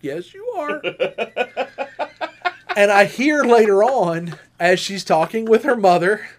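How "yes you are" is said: high, laughing mock sweetness; amused girlfriend, high and giggly; teasing laugh. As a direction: tease him through a laugh. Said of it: "And she goes".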